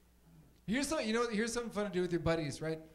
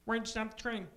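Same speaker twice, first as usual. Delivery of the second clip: higher-pitched puppet frog voice imitating a movie actor; Kermit the Frog; 5 semitones higher than usual. Frog puppet imitating an actor in a train scene, high puppet voice. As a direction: do a frog puppet asking in bewilderment. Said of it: "my impression of Kermit the Frog".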